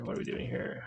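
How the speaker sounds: softly whispered repeat; whispered echo of his own question. Real, not acted. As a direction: breathe the question to yourself. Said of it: "repeated question".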